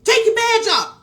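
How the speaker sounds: high-pitched mock holler; heckling bystanders. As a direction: mimic a heckler yelling from the back. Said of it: "you hear some dudes in the background saying".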